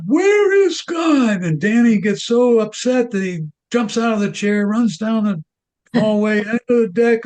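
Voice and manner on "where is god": slow, high-pitched booming demand; booming minister. Acted. Minister questions the silent boy one last time, booming. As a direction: boom out each word, pitched way up. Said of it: "in a little more booming voice".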